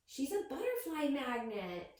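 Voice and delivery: quick amused aside from a stranger; lightly voiced bystander.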